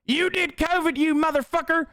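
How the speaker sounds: very high squeaky parody voice; shrill-free but sky-high mocking yell; mock attacker. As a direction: squeal it like a cartoon bully. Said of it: "being like".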